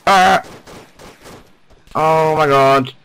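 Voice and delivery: frightened loud cries of alarm; real panic, voice jumping up; panicked. Real, not acted. Sniper spotted, squad mate shot.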